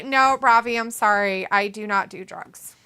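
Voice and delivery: ordinary delivery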